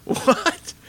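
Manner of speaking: high, sharp startled "What?". Retold or imitated. Pastor retelling his shock at being praised for wisdom, high startled "What?". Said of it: "And I went".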